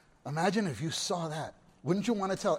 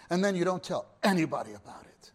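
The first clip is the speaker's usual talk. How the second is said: usual speaking voice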